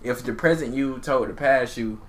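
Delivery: usual speaking voice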